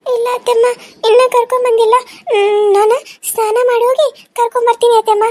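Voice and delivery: put-on high-pitched child voice; obedient little girl. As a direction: answer aunt like a dutiful small girl. (ಅತ್ತೆಗೆ ಉತ್ತರಿಸುವ ಪುಟ್ಟ ಹುಡುಗಿಯ ಧ್ವನಿ)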